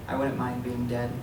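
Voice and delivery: usual speaking voice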